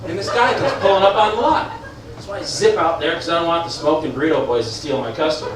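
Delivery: normal talking voice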